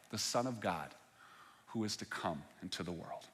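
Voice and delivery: soft, measured reading of the Gospel passage; scripture reading. Acted. Preacher reading John to the congregation, steady scripture reading. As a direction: read the passage aloud evenly to the congregation.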